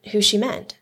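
plain reading voice

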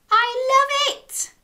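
high, drawn-out delight; delighted exclamation. Acted. Storyteller loves the ending, delighted squeal. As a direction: gush it out slowly, pitched high.

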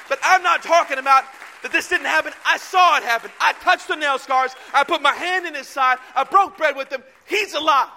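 impassioned preaching. Retold or imitated. Disciple refusing to deny Christ, loud impassioned defiance. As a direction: proclaim it forcefully, each phrase pushed out.

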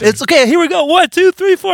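sing-song the count-in way up high; chirpy count-in. Retold or imitated. Bandmate cheerfully counting in the band, high chirpy voice.

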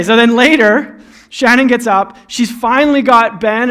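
ordinary talk

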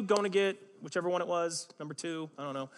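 ordinary delivery

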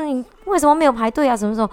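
imitating a shouting woman's rebuke; retold rant. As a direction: fire it off like an angry stranger. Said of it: "他就发飙，一直骂".

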